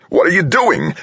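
very high, alarmed screech. A narrator's reading. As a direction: screech it in disbelief. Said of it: "Kaufman screeched".

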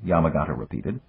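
ordinary delivery

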